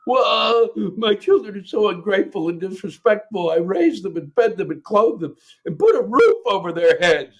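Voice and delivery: high, indignant mock-parent complaint; hammed-up aggrieved parent voice; whining parent. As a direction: mimic a self-pitying parent griping. Mimics complaining parents within rant.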